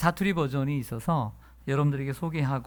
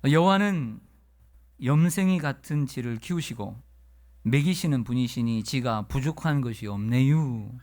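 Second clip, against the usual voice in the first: put-on easygoing Chungcheong accent, pitched up; Chungcheong dialect; 2 semitones higher than usual. Pastor reading the Chungcheong dialect psalm to the congregation, in a Chungcheong accent. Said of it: "예, 충청도 버전이 있습니다".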